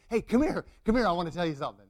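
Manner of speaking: loud, friendly hail, then quick; eager calling over. Acted. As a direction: call him over with good news. Forgiven man happily calls his debtor over.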